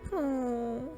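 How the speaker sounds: ordinary talk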